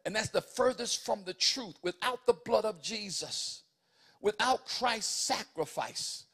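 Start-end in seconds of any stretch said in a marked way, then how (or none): 1.8-6.3 louder, hammering phrases over the organ; impassioned preaching. Acted. Preacher asking the church do you know him, impassioned. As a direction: lift the church with the blood of Jesus.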